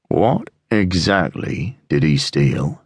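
low, slow, quietly menacing; slow quiet threat. Acted. Sergeant questioning the apple seller, slow and menacing. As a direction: let each word hang with quiet threat. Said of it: "The voice was slow, quiet, and yet threatening".

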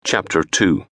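ordinary delivery

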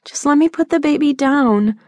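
put-on pleading teen correcting her mother; teen daughter, protesting. A narrator's reading. Daughter Jordan voiced by narrator.

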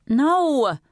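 high, sudden outburst; fired-up protest. A narrator's reading. Teenage girl objecting to her mother's plan, fired-up high protest. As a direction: burst out in hot refusal. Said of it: "Lee fired up".

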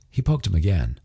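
usual speaking voice

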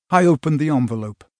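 usual speaking voice

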